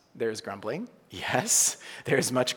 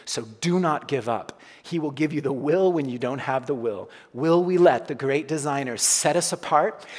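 louder, rising sermon delivery; preaching at full force; 4 dB louder than usual. Acted. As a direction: preach it out to the room with fervor.

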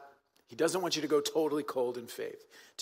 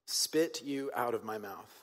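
slow repeat; 3 semitones lower, 3 dB quieter, slower than usual. Shown as a demonstration. Repeats words for weight.

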